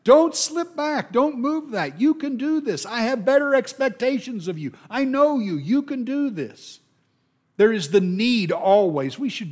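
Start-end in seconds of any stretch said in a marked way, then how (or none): 0.0-6.8 much higher, urgent cheering on; rally a struggling friend, pitch lifted high; the Hebrew writer urging his readers on, higher and insistent; eager encouragement. Retold or imitated. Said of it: "He will talk about how you did so well".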